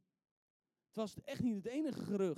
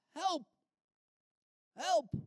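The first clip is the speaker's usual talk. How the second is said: high, loud single-word calls; cry for help; 9 semitones higher, 8 dB louder than usual. Acted. Preacher enacts Bartimeus simply calling for help, high loud cries. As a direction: shout for rescue, pausing between calls. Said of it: "Eigenlijk riep hij gewoon".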